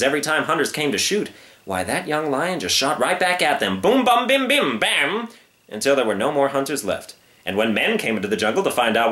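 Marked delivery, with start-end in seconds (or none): 3.8-5.3 high, punchy bang noises; gunshot sounds. A narrator's reading. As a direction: fire off the shots like a kid playing guns. Gunfire sound effects in story.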